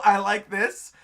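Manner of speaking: usual speaking voice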